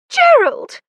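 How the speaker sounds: high, loud cry of alarm; crying out a name. A narrator's reading. Lucy crying out to Gerald as the door opens, high and loud. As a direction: call his name out sharply, startled. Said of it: "cried Lucy".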